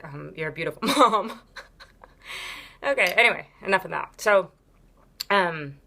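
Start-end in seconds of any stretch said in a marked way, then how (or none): 0.4-1.5 quoting with a laugh breaking in; retelling the stranger's line while laughing; laughing through quote. Retold or imitated. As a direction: laugh mid-quote at the absurd compliment. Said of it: "somebody said".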